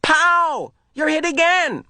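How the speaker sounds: high playful yell. Acted. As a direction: keep shooting in fun. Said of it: "Asher yelled".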